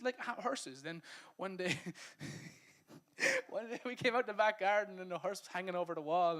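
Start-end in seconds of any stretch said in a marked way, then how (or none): 3.0-3.4 sudden high laugh; a sudden high laugh at his own story; high burst of laughter. Real, not acted.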